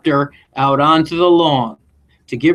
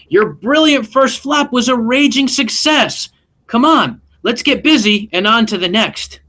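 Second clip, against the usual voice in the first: higher, encouraging great aunt; lightly voiced great aunt; 8 semitones higher than usual. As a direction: reassure her like a cheerful old aunt. Aunt voiced again, high.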